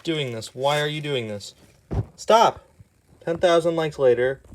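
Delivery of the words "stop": exasperated. Real, not acted. Begging the comic to stop.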